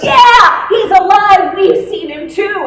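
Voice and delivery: loud, high, bursting announcement; rushing into the upper room, loud and joyful; excited news. Acted. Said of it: "we were like".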